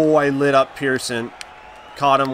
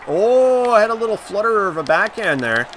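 excited; 7 semitones higher, 5 dB louder than usual. Real, not acted. Excited reaction to own play.

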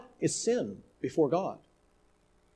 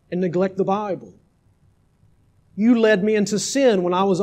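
louder, hateful voice of the dying son; read with anger; 4 semitones higher, 8 dB louder than usual. A narrator's reading. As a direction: accuse the father with bitter anger. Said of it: "or rather of hatred and anger".

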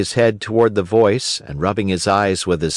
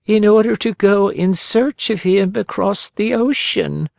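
put-on higher old-woman voice, sorrowful; little old woman; 10 semitones higher than usual. Old woman telling Pinocchio of a father at sea, worried.

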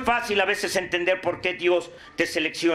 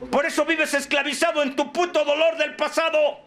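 high, forceful rebuke from the podium; fiery preaching; 8 semitones higher than usual. Acted. Speaker rebukes those who won't forgive, fiery preaching. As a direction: throw each accusation out in a raised pitch.

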